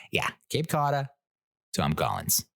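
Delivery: put-on Boston accent. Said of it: "order both at the same time in a Boston accent".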